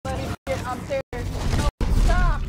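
high, rising panic to a loud shriek; frantic high cry in the game; panicked yelling. Real, not acted. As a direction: scream for them to stop.

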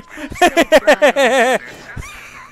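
long, loud, high cackling laugh; laughing hard. Real, not acted. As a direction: crack up in rapid loud ha-has. Laughing at the game.

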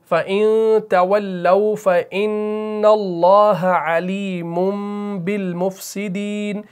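slow chant with long held vowels; Quran recitation. Shown as a demonstration. Teacher reads the verse out for learners, slow chant. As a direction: recite very slowly, humming each nasal.

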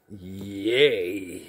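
mock cheer. Talking up paid workouts in basic training, mock cheer. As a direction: stretch out a half-hearted little cheer.

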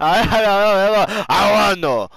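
loud, high, stammering mock catchphrase; hammed-up mimicry. Mocking the friend's stock answer, hammed-up mimicry. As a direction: blow it up into a silly loud garble.

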